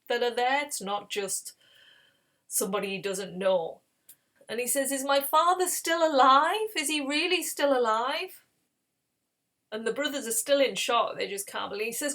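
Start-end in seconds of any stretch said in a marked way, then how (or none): 4.9-8.4 high, emotional questioning; acted anxious, raised-pitch question; urgent hopeful question. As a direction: ask urgently, voice lifted with hope. Weeping Joseph asks after his father.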